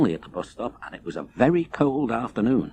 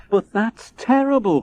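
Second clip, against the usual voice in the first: higher, louder outcry of dismay; upset little girl; 8 semitones higher, 3 dB louder than usual. Acted. Leonie to the wolf, hearing his kind were killed: a raised cry. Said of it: "cried Leonie".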